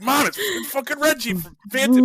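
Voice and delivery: high, loud excited blurt; like a thrilled teen grabbing mom's arm; excited kid. Retold or imitated. Kid excitedly telling his mom.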